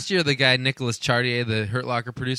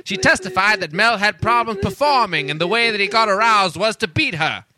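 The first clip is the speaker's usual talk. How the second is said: put-on old-timey newscaster; 5 semitones higher than usual. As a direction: announce it like a twenties newsreel. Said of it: "read it in an old-timey voice".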